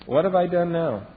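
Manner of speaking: high, put-upon mock whine; whiny youngest child. Youngest child complaining as sisters run off, high whine. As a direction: play the put-upon little kid. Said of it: "sisters go running off".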